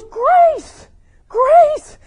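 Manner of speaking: high, loud joyful shout; ecstatic cry of grace. Retold or imitated. Bridget crying out grace over the open Bible, high and loud. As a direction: cry the word out like a revelation.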